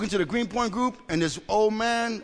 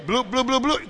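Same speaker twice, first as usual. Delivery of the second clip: louder, higher bouncy bloops; imitated bubbling; 6 semitones higher, 5 dB louder than usual. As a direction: pop out a string of bloops. Imitates feelings bubbling up inside.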